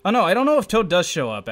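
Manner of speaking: ordinary talk, nothing marked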